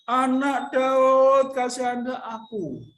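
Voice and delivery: loud, drawn-out plea, slow on Daud. Acted. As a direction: cry out even harder for mercy. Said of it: "semakin keras ia berseru".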